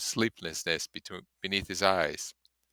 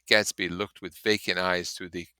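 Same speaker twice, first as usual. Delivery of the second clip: ordinary delivery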